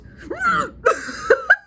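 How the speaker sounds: loud, high comic gagging noise; mock retching. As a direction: imitate someone about to throw up. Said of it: "Girlfriend goes".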